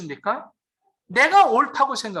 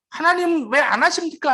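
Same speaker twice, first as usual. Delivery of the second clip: higher-pitched, mildly indignant protest; mock indignant complaint; 7 semitones higher than usual. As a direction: imitate someone taking God to task. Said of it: "안 하시면 화가 나는 거예요". (살짝 화난 듯 따지는 흉내)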